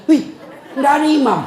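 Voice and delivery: high, loud startled cry; kaget. Retold or imitated. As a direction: imitate a boy's startled discovery. Boy discovers imam is gone. (seruan kaget, tinggi dan keras)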